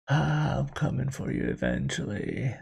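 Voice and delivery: a hushed, sinking, ominous threat; creepy old dude. Acted. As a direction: draw it out quietly like a creepy stalker. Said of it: "it's a creepy old dude".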